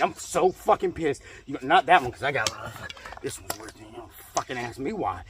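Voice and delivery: normal talking voice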